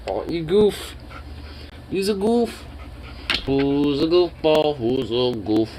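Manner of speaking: teasing sing-song for a puppy. Real, not acted. Cooing to his dog.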